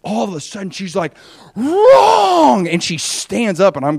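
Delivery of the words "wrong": high, loud outburst on one drawn-out word; erupting teacher. Retold or imitated. Teacher erupts at the student's speech, loud outrage. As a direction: blast it out as a sudden verdict. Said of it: "erupts out of her chair".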